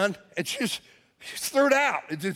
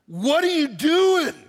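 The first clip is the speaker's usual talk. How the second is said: slow, loud, higher demand; confronting shout; 7 semitones higher, 4 dB louder, slower than usual. Retold or imitated. Pastor confronting littering woman, indignant shout. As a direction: call her out sharply.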